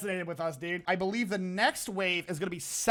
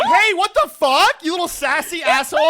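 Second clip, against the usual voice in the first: mock outrage; 8 semitones higher, 11 dB louder than usual. Mock outrage at friend's reply.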